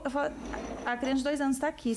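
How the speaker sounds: normal talking voice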